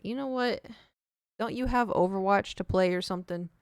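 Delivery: normal talking voice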